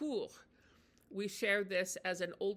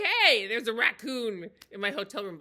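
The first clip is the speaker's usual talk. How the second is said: mock complaint; 10 dB louder than usual. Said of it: "I wanna say".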